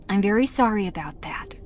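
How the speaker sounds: a small embarrassed whispered apology. Real, not acted. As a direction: breathe it out under your breath. Aside after an awkward explanation.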